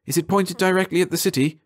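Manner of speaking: put-on worried girl checking the aim; anxious question. A narrator's reading. Mara anxiously asking Eric about the pyramid. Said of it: "Mara asked anxiously".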